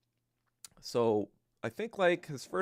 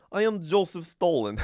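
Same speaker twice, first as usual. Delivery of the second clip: put-on louder, hammy Russian-accented announcement; mock Russian Stalin; 5 semitones higher, 5 dB louder than usual. Streamer trying out his made-up Georgian-Russian dictator, mock Russian accent. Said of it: "don't even know what the Russian accent sounds like".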